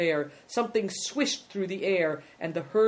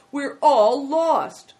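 loud, high, long-drawn wail; despairing cry; 10 semitones higher, 10 dB louder, much slower than usual. Acted. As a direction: wail it slowly, all hope gone. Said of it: "he cried despairingly".

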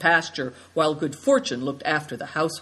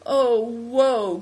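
mock lament, louder and higher; woeful cry; 6 semitones higher, 4 dB louder than usual. A narrator's reading. Daughter laments being asked to help. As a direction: wail your dismay lightly. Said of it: "cried Seven Stars".

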